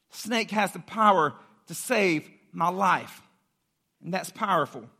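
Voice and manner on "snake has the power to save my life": slow, weighty declaration in separated phrases; slow right down and let each phrase land; slow emphatic repeat. Acted. Preacher repeating the snakebite victim's hope, slow and deliberate.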